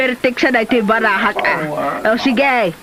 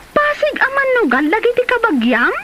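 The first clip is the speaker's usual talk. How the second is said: shocked question; 8 semitones higher than usual. Acted. Shocked question about gambling relatives.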